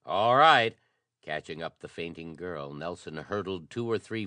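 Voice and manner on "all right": loud reply. Acted. Urgent reply in a fight.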